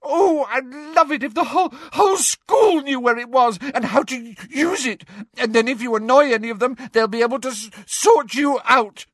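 high, loud wailing rant; sobbing tirade. A narrator's reading. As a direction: build from tearful giving-in to a shrill outburst. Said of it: "now sobbing into her hands".

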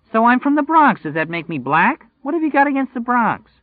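very high, loud indignant woman; a Bronx girl taking offence, squeaky and loud; offended Bronx girlfriend. Acted.

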